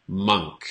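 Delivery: monk with the uh vowel, falling; correct pronunciation. Shown as a demonstration. Teacher modelling monk for learners. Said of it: "but the pronunciation is".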